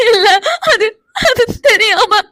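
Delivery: broken, halting sobs; crying too much to finish; tearful stammering. Acted. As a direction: falter through tears over an excuse. Crying wife stammers an excuse.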